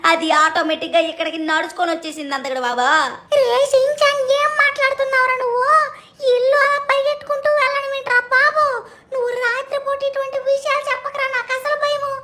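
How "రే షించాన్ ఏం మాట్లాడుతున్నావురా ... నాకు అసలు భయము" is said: much higher, squeaky cartoon sidekick, worried; high cartoon buddy voice, anxious; cartoon robot cat voice. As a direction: pitch way up for the sidekick, uneasy. Sidekick voice, scared.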